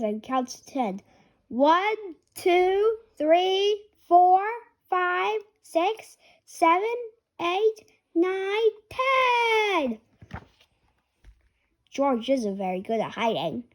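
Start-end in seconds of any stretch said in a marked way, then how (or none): 1.5-10.0 higher sing-song count; little pig counting to ten; counting aloud. Acted. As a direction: count it like a child seeking. Counting in hide and seek.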